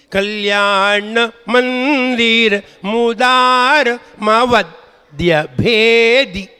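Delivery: slow, drawn-out chanted Sanskrit verse; teacher chanting a verse line for the pupil to copy; slow verse recitation. Shown as a demonstration. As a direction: intone each syllable slowly, like a prayer.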